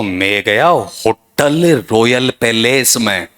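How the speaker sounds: slow, drawn-out "पैलेस" as pe-LAYS; hammed-up, laboured "पैलेस" with heavy second syllable; mocked wrong pronunciation. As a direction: imitate a local speaker stretching "पैलेस" to pe-LEES-style long E. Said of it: "ऐसा नहीं होना चाहिए".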